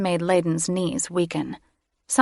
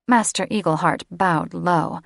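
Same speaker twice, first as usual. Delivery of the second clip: ordinary delivery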